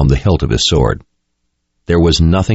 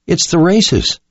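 put-on high, anxious young woman's voice; worried, high-pitched and urgent; 11 semitones higher, slower than usual. A narrator's reading. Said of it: "came Jensen's worried voice".

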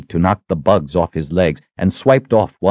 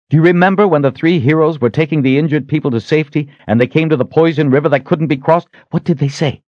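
lightly voiced young hero, higher and persuading; Richard, insistent; 8 semitones higher, 3 dB louder than usual. A narrator's reading. Richard reminding the Sister of a heroes' story, insistent. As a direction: press the case in a somewhat raised pitch.

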